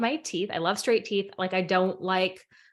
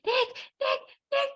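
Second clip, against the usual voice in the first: very high, squealed mock yell; high-pitched re-enacted calling; retold yelling; slower than usual. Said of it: "I'm yelling at Nick".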